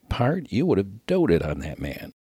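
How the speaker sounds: ordinary delivery, nothing marked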